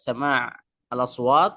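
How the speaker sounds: ordinary delivery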